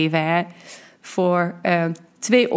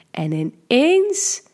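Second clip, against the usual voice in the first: ordinary delivery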